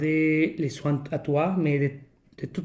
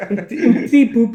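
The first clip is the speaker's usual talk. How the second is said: laughing through the words; chuckling while speaking; 6 semitones higher, 7 dB louder, much faster than usual. Real, not acted. Laughing at the teasing.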